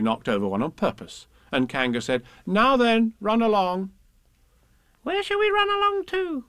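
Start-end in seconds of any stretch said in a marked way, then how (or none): 2.4-3.9 higher, louder motherly shooing; motherly Kanga. Acted. Kanga shooing Roo and Tigger out, higher motherly voice. 5.0-6.4 put-on very high squeaky child voice; little Roo. Roo asks Kanga where to go, squeaky high.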